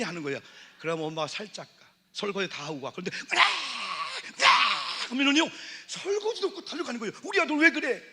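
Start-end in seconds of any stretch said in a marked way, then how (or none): 3.1-5.1 loud mock infant scream; hammed-up baby shriek; imitated baby scream. 7.2-8.0 high, loud fussing mother; mock alarmed mom. Mother rushing to son.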